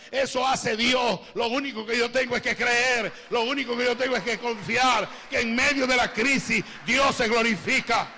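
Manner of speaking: booming revival preacher declaring God's power; fervent preaching. Acted. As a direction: preach it out to the whole church. Preaching at full force.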